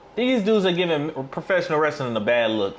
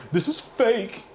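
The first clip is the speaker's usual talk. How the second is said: higher sneering imitation; mocking quote; 6 semitones higher, slower than usual. Streamer mocking the wrestler, sneering parody. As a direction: parrot it back in a mocking voice. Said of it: "to tell them".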